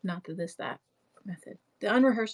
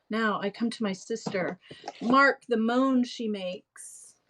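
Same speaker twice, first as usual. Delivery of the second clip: hammed-up clown pleading over his props; acted Shakespeare with big emphasis; theatrical Shakespeare reading; 2 semitones higher than usual. Continues monologue to the end.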